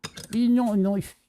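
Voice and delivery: put-on slow Australian accent, "knife" said as noife; Aussie film quote, drawn out. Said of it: "I cannot do the Australian accent".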